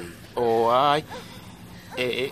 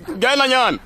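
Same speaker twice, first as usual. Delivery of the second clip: calling out; 12 semitones higher, 7 dB louder, slower than usual. Acted. Calling son in.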